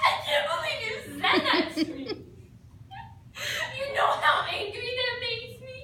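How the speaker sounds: badly faked tearful outburst; fake crying. Actor redoes the outburst with a laughing fake cry. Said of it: "it's recommended that you cry".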